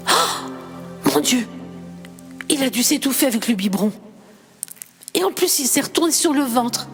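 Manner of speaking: high, frantic blaming cry; anguished accusing shout. Acted. Marc screaming at the mother over his dead son. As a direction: hurl the blame at her. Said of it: "hurle Marc".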